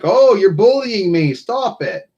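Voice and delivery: high, whiny mock complaint; mock whiny victim. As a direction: play the whining crybaby. Mocking critics who claim bullying.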